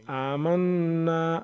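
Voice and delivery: आमन्ना with the doubled न held as a humming nasal ghunna; ghunna nasal hold. Shown as a demonstration. Teacher showing ghunna on the shadda, slow nasal hold. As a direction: stretch the न of आमन्ना through the nose, slow. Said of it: "तो हम ये गुन्ना करेंगे".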